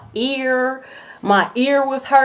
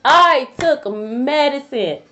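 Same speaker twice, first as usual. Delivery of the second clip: I TOOK MEDICINE stretched out slowly and pitched up; exasperated emphasis; 4 semitones higher, much slower than usual. Real, not acted. Telling viewers that medicine did not help, exasperated emphasis on "I took medicine". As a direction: draw out every word of "I took medicine" in exasperation.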